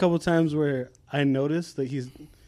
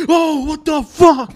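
high, loud, slow startled cry; startled dad; 12 semitones higher, 8 dB louder, slower than usual. Retold or imitated. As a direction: jump out of your skin. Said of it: "He's like".

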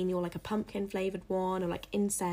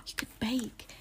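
excited gasp, then higher, soft, drawn-out words; really excited; 5 semitones higher, 5 dB quieter, slower than usual. Real, not acted. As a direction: gasp at your own brilliant idea, slowly savouring it. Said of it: "I got really excited then".

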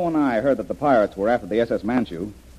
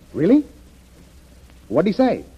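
sharp high "Really?" at startling news; jolted by what the caller says; surprised; 8 semitones higher, 3 dB louder than usual. Acted. On the phone to the radio engineer, surprised.

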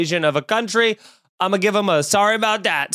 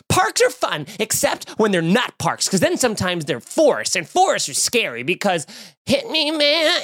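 put-on five-year-old's inner monologue; 3 semitones higher than usual. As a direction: ramble like a little kid, pitch jumping up. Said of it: "reading a five-year-old's inner monologue".

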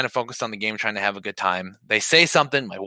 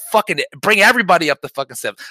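louder, higher reenacted yell; rally a fight, retold at raised volume; heated mock screaming; retold screaming challenge; 6 semitones higher, 6 dB louder than usual. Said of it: "at this point, I'm screaming".

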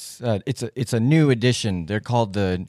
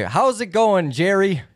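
high-pitched, chummy bartender hello; hearty greeting; 7 semitones higher than usual. Acted. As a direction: greet a customer with showy cheer. Bartender greets customer over the bar.

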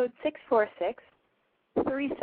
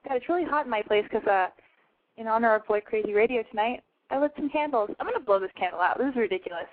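normal talking voice

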